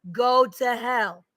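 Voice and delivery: very slow, each word dragged; stretched-out bitter curse. Real, not acted. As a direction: draw out Go to hell. Cursing an absent man.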